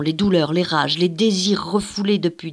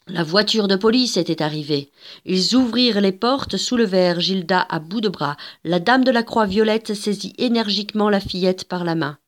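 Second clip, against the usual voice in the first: ordinary delivery, nothing marked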